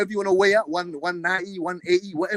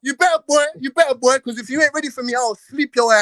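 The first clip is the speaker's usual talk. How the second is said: raised, higher-pitched warning; heated threat; 5 semitones higher, 4 dB louder than usual. Real, not acted. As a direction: warn a rival you mean it. Threatening opponent in shouting match.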